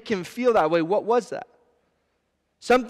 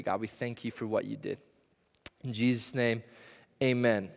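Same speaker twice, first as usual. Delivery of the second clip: lower, softer earnest prayer; 8 semitones lower, 5 dB quieter than usual. Real, not acted. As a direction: pray quietly, sincerely, to God. Pastor prays before the sermon.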